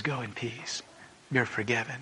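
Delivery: tender forgiveness. Acted. The Lord speaks to a desperate sinner, hushed and tender. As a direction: hush it like a comforting secret. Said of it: "looks at you and says".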